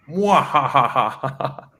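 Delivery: light villain cackle; little evil laugh. Shown as a demonstration. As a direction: give a small evil mwah-ha-ha. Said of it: "a little evil laugh, like".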